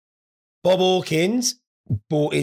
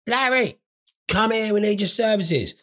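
higher-pitched retold summons; calling out; 6 semitones higher than usual. Summoning his brother for horse advice, calling out. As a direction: call him over from the back.

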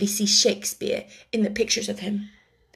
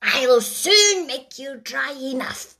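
the mouse taking charge, pitched up; 4 semitones higher than usual. A narrator's reading. The mouse calls the wet animals to order, high and fussy.

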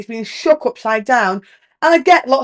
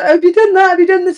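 high, loud nagging grandma; raised-pitch mock nagging shout; imitated shouting; 8 semitones higher, 5 dB louder than usual. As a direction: call out sharply like a grandma checking up on her husband. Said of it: "she used to shout my granddad".

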